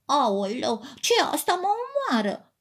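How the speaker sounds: high startled cry of a teddy bear; startled yelp. A narrator's reading. Teddy bear jumps up as crayons fall on him, startled high cry. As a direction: yelp as if burnt. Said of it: "Ursulețul sări ca ars".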